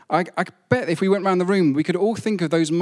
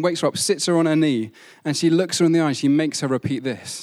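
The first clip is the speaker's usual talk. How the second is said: normal talking voice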